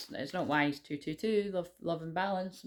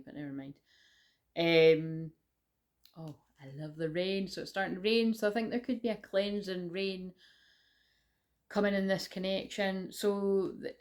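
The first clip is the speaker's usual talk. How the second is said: ordinary talk, nothing marked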